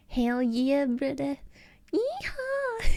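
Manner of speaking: high, whooping Southern yeehaw; southern accent. Acted. American streamer showing off a southern accent to viewers, hammed-up Southern. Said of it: "you like that southern accent".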